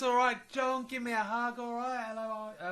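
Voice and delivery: high, loud chummy street greeting; imitating Michelle. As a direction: greet him like an old pal, up high. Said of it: "She comes up to me and goes".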